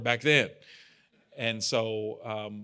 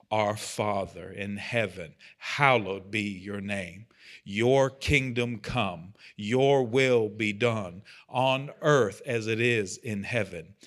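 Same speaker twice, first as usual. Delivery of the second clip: slow, louder prayer read aloud in unison; group prayer recital; 4 dB louder, slower than usual. Shown as a demonstration. Leader reads the Lord's Prayer aloud with the group, slow and louder. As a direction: recite it slowly and fully, word by word. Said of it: "let's try to read this out loud together".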